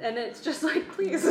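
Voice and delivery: high, loud, laughing please; laughing exasperation; exasperated laughing plea. Real, not acted. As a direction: plead through a laugh.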